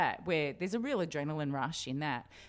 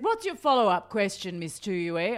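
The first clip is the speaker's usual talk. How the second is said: higher, louder imitation of a politician's demand; put-on politician voice, pressing for more; Gough Whitlam; 5 semitones higher, 7 dB louder than usual. Politician to nervous cadet journalist, imitated pressing demand. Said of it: "In that Gough Whitlam voice".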